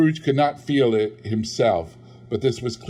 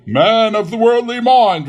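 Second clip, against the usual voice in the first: high, loud eerie call from the spectre; ghostly address; 12 semitones higher, 9 dB louder than usual. Acted. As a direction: intone it like a wailing ghost.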